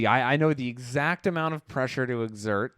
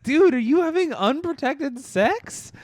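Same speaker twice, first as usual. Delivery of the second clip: higher, slower mock disbelief; incredulous self; 12 semitones higher than usual. Roommate questions his friend, higher mock disbelief. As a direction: ask it in shocked disbelief. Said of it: "I was like".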